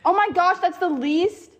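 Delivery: loud, high disbelief; shocked. Real, not acted. Shock at losing the bid.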